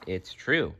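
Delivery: plain reading voice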